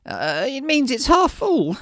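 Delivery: high, slow, unsure answer; quizzical. Acted. Villager explains the obvious to his commander, quizzical. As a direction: stretch it out like a puzzled question. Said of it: "ends his sentence with a quizzical lull".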